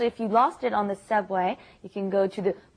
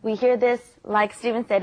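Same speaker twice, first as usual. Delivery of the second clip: ordinary talk, nothing marked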